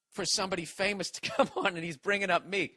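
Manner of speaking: usual speaking voice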